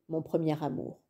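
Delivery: ordinary delivery, nothing marked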